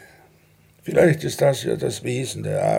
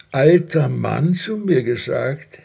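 "alter Mann" slowed and punched louder; stress on alter Mann; 4 dB louder, slower than usual. Acted. Husband to wife, stressing "alter Mann" in mock offence. Husband echoes wife's jab.

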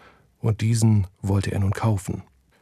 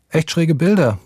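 called out brightly, a little higher; calling after him; 6 semitones higher, 3 dB louder than usual. A narrator's reading. Girl calls after Hardy from his room, lightly raised voice. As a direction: call it after him into the kitchen. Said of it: "rief sie ihm nach".

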